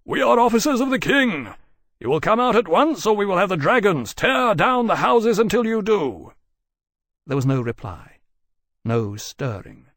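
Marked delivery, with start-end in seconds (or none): 0.0-6.3 loud, high shout through cupped hands; shouted order. A narrator's reading. Lawrence shouting to villagers hiding in their houses, commanding. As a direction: bellow it out to the hidden village. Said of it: "cupped his hands around his mouth and shouted".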